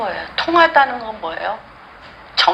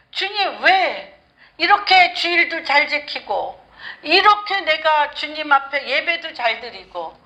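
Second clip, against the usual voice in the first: rising, pleading cries of why to the Lord; Hannah's pleading lament; 4 semitones higher than usual. Acted. Preacher voicing Hannah crying to God, pleading. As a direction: beg God like an abandoned woman.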